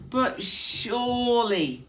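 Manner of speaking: high, slow, loud squeaky protest; high mouse voice, pleading. Acted. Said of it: "said Mouse".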